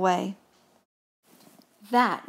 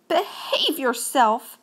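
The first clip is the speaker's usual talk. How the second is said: slow, raised scolding; 4 semitones higher, slower than usual. A narrator's reading. Gus scolds Mouse for making a mess, scolding. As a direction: tell someone off, drawn out.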